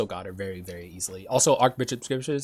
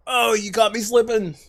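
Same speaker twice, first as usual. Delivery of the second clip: voice crack; 11 semitones higher than usual. Real, not acted. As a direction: voice cracking up high. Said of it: "Sorry for that voice crack".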